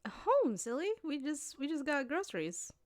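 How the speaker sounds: put-on high, light teasing girlfriend voice; playful girlfriend. Playing girlfriend, teasing reassurance.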